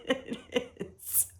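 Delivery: breathy laugh through the words. Real, not acted. Laughing aside.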